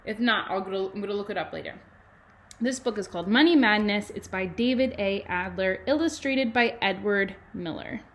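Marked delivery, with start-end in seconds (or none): 2.6-8.0 louder, lively storybook read with bouncing tune; reading aloud to children. Acted. Teacher reading a money book to kindergarten, lively and loud. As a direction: read it out big and bright for little kids.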